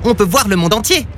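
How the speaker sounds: high-pitched pigeon voice; pigeon character. A narrator's reading. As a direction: assure him in a high little bird voice. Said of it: "lui assura un pigeon".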